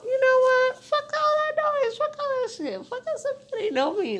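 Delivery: high-pitched, loud defiance; defiant outburst. Retold or imitated. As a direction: throw off all doubts at high pitch. Defiantly dismissing own doubts.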